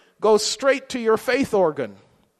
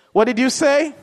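higher, louder amused disbelief; mock surprised; 5 semitones higher, 5 dB louder than usual. Rich listener laughing off an insult, mock surprised. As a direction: toss it back, too happy to care.

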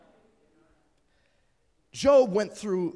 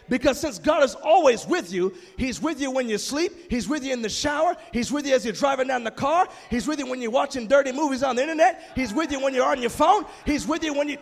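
rising louder and higher through the list, ending in a shout; building preaching; 5 semitones higher, 4 dB louder than usual. Real, not acted. Preacher building a list to a shouted climax. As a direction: climb with each line to a shouted peak.

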